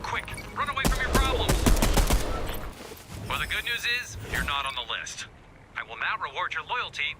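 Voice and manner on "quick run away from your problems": high-pitched, hurried call to flee; sudden high-pitched alarm; urgent urging. Acted. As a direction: urge them off in a high, excited voice. Urges player to flee.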